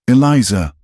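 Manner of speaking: loud shouted name; calling out scream. A narrator's reading. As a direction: call her name loudly. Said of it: "The scream came from the general store".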